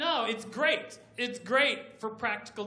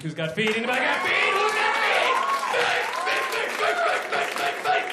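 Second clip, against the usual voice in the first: absurd crowd-rousing that escalates into squeals; mock hype, building to shrieking; 9 semitones higher than usual. Comic asking the audience who has feet, building to shrieks.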